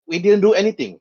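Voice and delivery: high-pitched character plea; protesting Jawa. Acted. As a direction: protest innocence as a little alien. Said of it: "The Jawas actually hands up".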